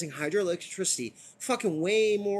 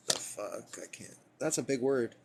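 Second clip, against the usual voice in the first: usual speaking voice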